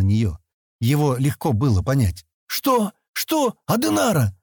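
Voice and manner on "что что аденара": high, incredulous questioning; astonished. A narrator's reading. Sten stunned by the girl's name, astonished. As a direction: repeat it in sharp disbelief, pitch leaping up. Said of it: "Он изумлённо уставился на неё".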